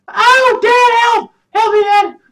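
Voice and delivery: put-on very high, loud little-kid yell; squeaky kid voice shouting help; child's cry for help. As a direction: shriek for Dad like a scared small child. Son character in danger.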